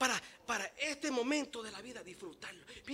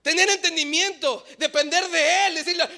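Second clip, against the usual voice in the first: loud, rising preaching cry; preaching at full force; 8 semitones higher, 16 dB louder than usual. Acted. Pastor urging the church to cling to God, loud. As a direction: lift it up to the Lord.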